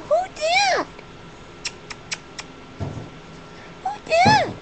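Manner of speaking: very high, squeaky bird talk; quoting pet bird. Retold or imitated. Owner repeats what her bird said, squeaky pet voice. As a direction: mimic a little parrot in a high squeak. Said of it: "She said".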